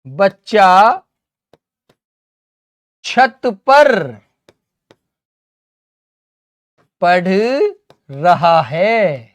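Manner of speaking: word by word with long pauses; halting classroom dictation, last words drawn out; slow dictation. Shown as a demonstration. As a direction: dictate it piece by piece for note-takers. Dictates sentence slowly while writing.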